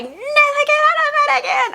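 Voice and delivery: very high-pitched mimicked horror-movie scream; imitated screaming. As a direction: screech it like the girl in a horror film. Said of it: "screaming it".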